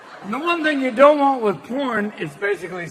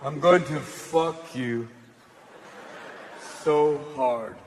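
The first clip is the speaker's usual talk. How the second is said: low, slow, wooden line reading; low stilted porn line; bad porn actor; 8 semitones lower, 5 dB quieter, slower than usual. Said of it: "bad actors who are always like".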